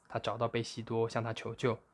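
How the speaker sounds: ordinary delivery, nothing marked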